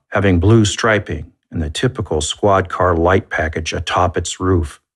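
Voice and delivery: ordinary delivery, nothing marked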